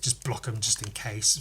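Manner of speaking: usual speaking voice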